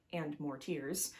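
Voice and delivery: ordinary delivery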